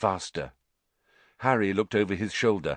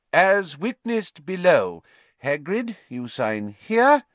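louder, slow formal announcement; official reading a document; 8 semitones higher, 4 dB louder, slower than usual. A narrator's reading. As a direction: read it out officiously.

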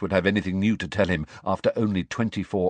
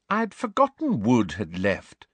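vaguely; 4 semitones higher than usual. A narrator's reading. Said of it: "said Hermione vaguely".

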